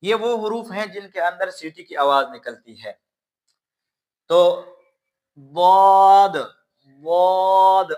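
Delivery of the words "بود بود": بود said loud and slow, heavy d from tongue edge; tajweed daad demo. Shown as a demonstration. Teacher modelling daad loudly for students. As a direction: hold بود slowly and firmly. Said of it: "اب بود کو کیسے ادا کرنا ہے؟".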